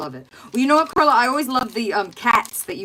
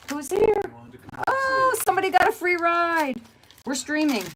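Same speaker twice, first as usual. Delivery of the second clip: high, bright surprised greeting; light up as someone walks in; excited greeting; 11 semitones higher than usual. Real, not acted. Husband arrives home.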